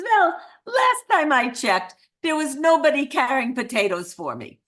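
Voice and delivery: high, loud, hammed-up Eastern European accent; indignant mock Eastern European accent; bad Eastern European accent. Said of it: "I do the absolute worst Eastern European accent".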